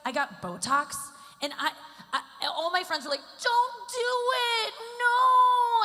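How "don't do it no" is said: very high, drawn-out protest; high, swelling mock plea; mock horrified wail. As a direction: wail it like aghast friends, hammed up. Friends' horrified plea, acted.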